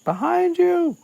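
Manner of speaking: high, loud mock warning shout; calling out. Wood warning Harry mid-game, calling out. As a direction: yell it across a stormy pitch, hammed-up. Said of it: "he hears Wood calling his name".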